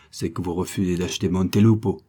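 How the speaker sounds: usual speaking voice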